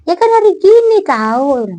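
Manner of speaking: retorting. Retold or imitated. Retold quarrel with relative.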